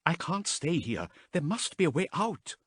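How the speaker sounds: high, tense resolve; desperate. A narrator's reading. Haas desperate to escape, high and tense. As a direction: push against panic.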